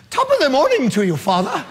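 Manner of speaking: high, lilting mock-Irish greeting. Man making up with God, playful Irish accent. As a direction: put on a stage Irish accent. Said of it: "so I switch to Irish".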